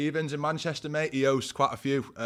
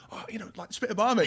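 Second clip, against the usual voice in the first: mate egging him on; 10 semitones higher, 4 dB louder than usual. Retold or imitated. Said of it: "your mate goes".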